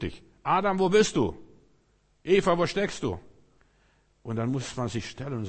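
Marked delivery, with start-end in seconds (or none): 0.4-3.2 high, loud call across the garden; God calling out. Acted. God calls Adam and Eva, loud and high. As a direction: boom out the summons to the hiding pair.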